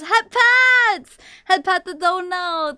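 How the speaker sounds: high, loud donor cheer; celebratory shout. Real, not acted. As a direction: call it out to thank a donor. Celebrates a donation with head pats.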